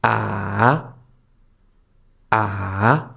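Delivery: Ah drawn out, down then up; dipping third tone. Shown as a demonstration. Teacher showing learners the third tone, Ah falling then rising. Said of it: "goes down and then up again".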